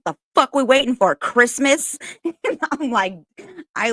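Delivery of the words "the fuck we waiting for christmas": high, loud grumpy granny yell; nana's road rage. Retold or imitated. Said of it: "She's like".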